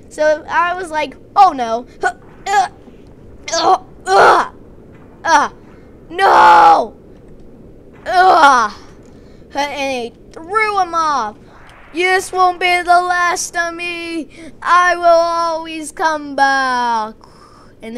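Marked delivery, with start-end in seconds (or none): 1.4-8.7 panicked stammer. Acted. Narrator voicing his panic. 11.9-17.2 slow, ominous villain voice; villain vow. Acted. Dying zombie vowing to Jeremy he will return, slow menacing voice.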